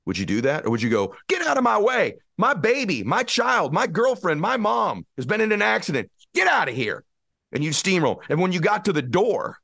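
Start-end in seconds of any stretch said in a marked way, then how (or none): 1.3-7.0 frantic parent clearing the way; desperate push past. Pretend. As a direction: shove through the crowd, shouting. Parent pushing past people.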